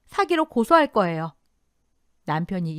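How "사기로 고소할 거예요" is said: raised, resolute voice; determined, higher-pitched threat; firm declaration. A narrator's reading. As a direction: state it firmly and louder. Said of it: "단단히 말했습니다".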